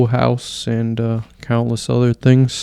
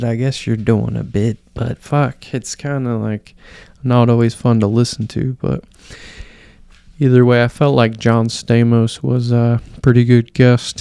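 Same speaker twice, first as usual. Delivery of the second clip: ordinary talk, nothing marked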